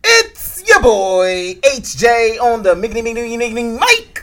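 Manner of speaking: high, loud hype-man intro; ringmaster. Pretend. Hype host self-intro.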